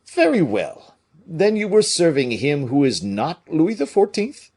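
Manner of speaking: hearty Porthos working it out. A narrator's reading. Porthos voiced.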